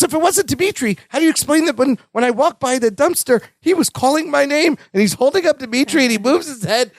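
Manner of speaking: high, fast excited Balki; high breathless Balki story. Retold or imitated. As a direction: rattle it off way up high. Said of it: "He goes".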